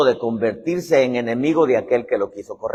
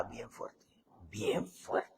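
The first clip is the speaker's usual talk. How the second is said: hushed whisper; hushed retelling; whispered gossip; 7 semitones lower, 22 dB quieter, much slower than usual. Retold or imitated. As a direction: whisper it like a secret.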